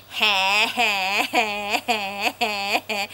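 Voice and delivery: a hint of a big mother duck laughing; mummy duck laugh. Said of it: "Mummy Duck goes".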